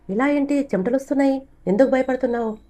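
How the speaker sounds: high, worried asking; high alarmed concern; alarmed question. Acted. Friend alarmed asking why afraid.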